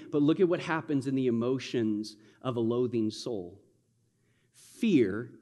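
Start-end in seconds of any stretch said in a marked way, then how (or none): none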